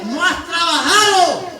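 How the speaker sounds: slow, loud, high rebuke as God; a lightly voiced stern God rebuking Jonah. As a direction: hold each word of a divine scolding.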